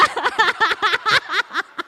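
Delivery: loud, high peals of laughter; big laugh. Real, not acted. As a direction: burst out laughing at your own joke. Joking about looking for a husband. (tertawa keras bernada tinggi)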